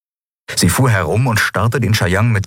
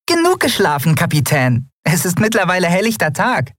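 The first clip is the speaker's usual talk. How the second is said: tiny piping high squeak; squeaky little voice; 13 semitones higher than usual. Acted. Nelen squeaks to wake Endriel, piping high. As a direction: squeak her name like a tiny creature.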